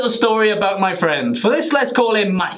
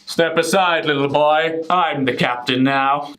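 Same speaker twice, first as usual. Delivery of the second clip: much lower deep voice; 8 semitones lower than usual. Retold or imitated. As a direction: say it low and commanding. Deep-voiced boy quoted.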